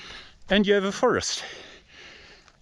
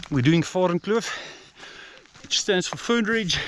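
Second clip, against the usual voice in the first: ordinary talk, nothing marked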